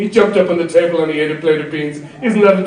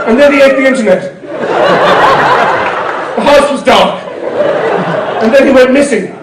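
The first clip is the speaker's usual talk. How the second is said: rising outrage; 6 semitones higher than usual. Acted. Escalating pet disaster list.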